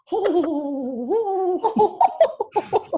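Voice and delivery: high, dainty ladylike laugh; British woman's laugh. Shown as a demonstration. Mom showing her British lady laugh. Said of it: "like a British, British woman".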